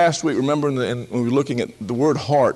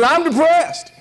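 high mock admission; 14 semitones higher, 4 dB louder, slower than usual. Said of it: "He said".

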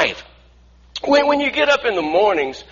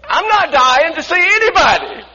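high, loud comic protest; mock indignant retort; 9 semitones higher, 5 dB louder than usual. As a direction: shout back in exaggerated disbelief. Mock indignant retort to overheard remark.

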